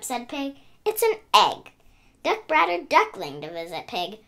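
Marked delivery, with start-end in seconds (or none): 0.8-1.7 insisting. Acted. Pig insists to Hen.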